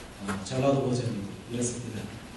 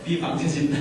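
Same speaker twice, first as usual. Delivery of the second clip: Bible dialect joke; 5 semitones higher than usual. Shown as a demonstration. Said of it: "성경적 사투리로".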